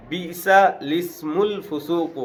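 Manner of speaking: slow melodic Quran recitation; measured Qur'anic chant; Quranic recitation. Shown as a demonstration. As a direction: draw the Arabic out slowly, chanting. Quranic verse recited in class.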